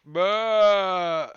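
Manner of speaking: loud high sheep bleat. Acted. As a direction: belt out a silly sheep bah.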